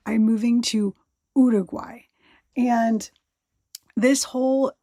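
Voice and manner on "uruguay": Uruguay the Spanish way, set into an English sentence; careful Spanish-style Uruguay; Spanish pronunciation of Uruguay. Shown as a demonstration. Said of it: "supposed to say it in Spanish".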